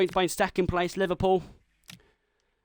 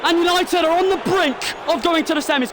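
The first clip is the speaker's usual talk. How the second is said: much higher, louder commentator roar; excited shout; 13 semitones higher, 5 dB louder than usual. Acted. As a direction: burst out as the ball hits the net. Penalty scored, near win.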